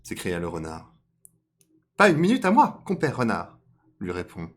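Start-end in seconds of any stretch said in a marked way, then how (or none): 2.0-3.6 high, loud cartoon rabbit. Rabbit brushing off Compère Renard, high loud voice. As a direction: answer back in a raised, forceful squeal. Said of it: "lui répond le lapin".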